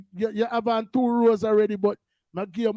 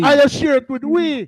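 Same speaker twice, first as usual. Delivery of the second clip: loud mock echo; 3 semitones higher, 7 dB louder than usual.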